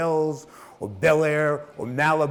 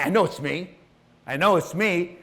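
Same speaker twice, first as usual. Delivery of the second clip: normal talking voice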